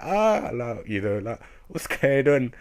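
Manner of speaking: high, loud mock astonishment; reacting with a hammed-up high gasp; stunned gasp. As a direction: gasp it out, then ask in shock. Narrator and character stunned together.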